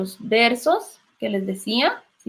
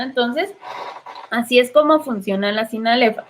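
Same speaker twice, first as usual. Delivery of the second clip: usual speaking voice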